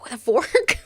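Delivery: loud, high, playful call; playful shout. Real, not acted. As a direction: shout the pun with a grin. Punning shout on song title.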